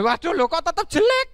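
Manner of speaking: loud, high cry of disbelief; high-pitched exasperated donkey; dismayed. Acted. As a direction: wail in frustration. Donkey still sounds ugly.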